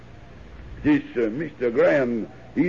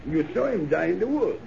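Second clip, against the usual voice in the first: ordinary talk, nothing marked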